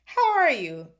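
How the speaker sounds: loud, high booming hello; roared greeting. A narrator's reading. Hunt leader greeting Nick, roaring. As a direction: roar it heartily. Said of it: "he roared".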